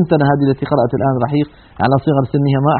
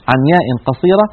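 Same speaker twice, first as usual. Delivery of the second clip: ordinary delivery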